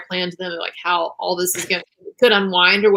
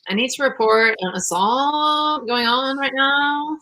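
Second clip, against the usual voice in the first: slow, drawn-out, higher-pitched imitation of a caller; unconvincingly concerned 911 caller, slow and raised; mock concerned caller; 5 semitones higher, slower than usual. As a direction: drag it out as a sarcastic impression of worry. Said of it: "she sounded really concerned. She's like".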